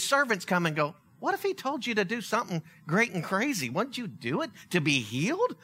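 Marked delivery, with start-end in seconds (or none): none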